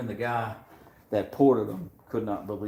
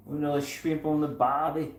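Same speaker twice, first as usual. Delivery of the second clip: higher put-on Australian accent; 6 semitones higher than usual. Doing his best Australian accent for a listener's request. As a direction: toss off a cliché line in a hammed-up Australian accent. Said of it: "My best Australian accent?".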